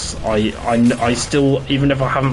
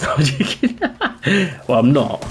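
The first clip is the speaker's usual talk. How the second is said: laughing; 5 semitones higher than usual. Real, not acted. Laughs at own joke.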